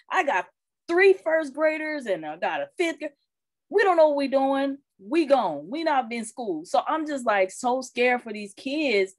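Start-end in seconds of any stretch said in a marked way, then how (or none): 0.1-6.6 high, carefree brother giving up; lightly voiced brother who's thrown in the towel; brother's shrug. Brother explaining to his sister he gave up on online school, high and careless. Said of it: "he's like".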